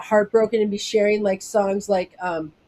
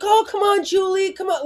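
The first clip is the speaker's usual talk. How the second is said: much higher, playful come on; mock coaxing; 11 semitones higher, 4 dB louder than usual. Friends reassuring new colleague Julie on her post, high mock coaxing. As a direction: coax her like a cheering friend. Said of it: "we could all jump on the post and be like".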